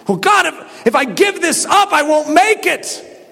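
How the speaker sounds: high-pitched, pleading objection; protesting to God. Acted. Believer bargaining with God over giving, protesting.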